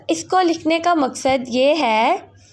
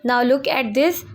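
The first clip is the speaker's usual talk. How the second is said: usual speaking voice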